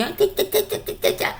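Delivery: high mock tutting, fading to a whisper; mocking animal lovers. Mocking animal lovers who'll judge her, high tutting. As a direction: mimic a disapproving tsk-tsk. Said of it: "all these fucking animal lovers are gonna be".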